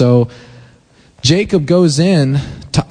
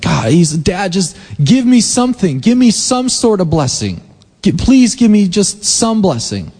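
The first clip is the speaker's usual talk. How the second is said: pleading; 8 semitones higher than usual. Acted. Esau begging father for blessing.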